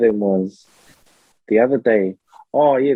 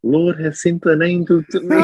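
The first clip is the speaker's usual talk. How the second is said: church lady voice; 5 semitones higher than usual. Retold or imitated.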